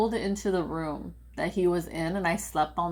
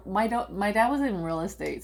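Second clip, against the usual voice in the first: ordinary delivery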